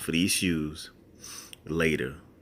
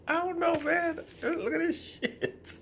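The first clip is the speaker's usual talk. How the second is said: much higher, laughing look at this; high laughing incredulity; giggling disbelief. Real, not acted. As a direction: squeal through the laughter.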